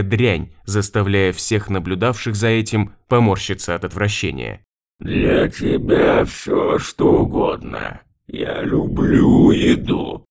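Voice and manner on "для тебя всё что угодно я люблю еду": slow, drawn-out gurgling whisper; drowned ghost. A narrator's reading. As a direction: stretch every sound, creepy and wet. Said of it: "растягивая буквы жутким, булькающим голосом". (жуткий булькающий голос)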